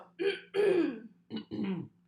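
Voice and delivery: loud throat clearing. Real, not acted. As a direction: clear your throat hard. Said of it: "a throat clear".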